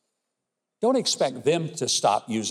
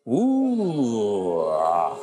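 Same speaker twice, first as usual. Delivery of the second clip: long, sliding "ooh" falling into "ah"; drawn-out groan of dismay, sinking in pitch; mock-appalled groan; 4 semitones lower, 3 dB louder than usual. Real, not acted. Commentator reacting to a clip on socialism, drawn-out falling groan.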